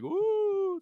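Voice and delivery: high spooky ghost wail; a quick high ghostly woo. Shown as a demonstration. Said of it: "a Ghost of Christmas Future, like".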